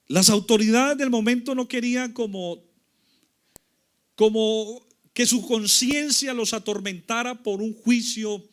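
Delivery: forceful, high-pitched sermon delivery; emphatic preaching. Real, not acted. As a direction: project the story to the whole congregation.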